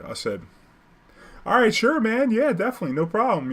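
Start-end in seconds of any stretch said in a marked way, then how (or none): 1.5-3.5 eagerly humoring a weird passenger, pitched up; overeager agreement. Retold or imitated.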